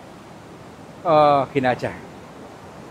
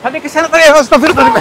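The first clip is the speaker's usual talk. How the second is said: startled shout; 9 semitones higher, 10 dB louder, faster than usual. Real, not acted. Startled by something in the dark.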